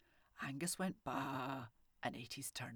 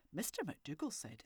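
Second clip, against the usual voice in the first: plain reading voice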